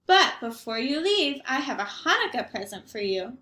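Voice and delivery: a cheerful, half-deaf grandmother greeting her guest; Baba Brena. Acted. Grandmother greeting the rabbi at her door, chirpy old lady voice.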